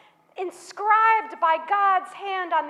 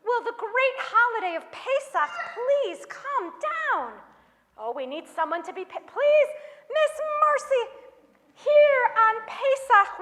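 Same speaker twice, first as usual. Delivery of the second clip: ordinary delivery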